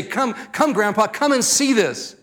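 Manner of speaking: put-on high, eager child calling Grandpa; lightly acted childlike eagerness; enthusiastic grandchild. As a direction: imitate an excited grandchild urging you over. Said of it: "the enthusiasm I see".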